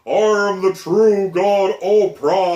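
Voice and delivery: huge, slow, booming divine voice; booming Oprah god voice. Retold or imitated. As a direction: boom it out like a towering god. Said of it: "I keep hearing her booming voice".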